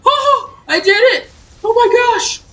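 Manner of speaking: very high, loud laughing cheer; real elated yell with laughter; triumphant shriek. Real, not acted. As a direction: scream of victory. Finally beats the hard sequence.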